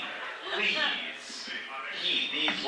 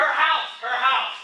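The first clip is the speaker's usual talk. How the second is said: higher, mock-serious echo of the punchline; repeating echo; 7 semitones higher, 3 dB louder, slower than usual. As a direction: echo it like a straight man. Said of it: "this is my part of your joke, is the repeating".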